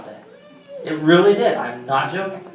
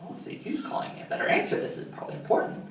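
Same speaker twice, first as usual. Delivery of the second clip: hushed, breathless whisper to himself; whispered inner thought; 12 dB quieter, faster than usual. Retold or imitated. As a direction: whisper it like a private panic. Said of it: "I'm like".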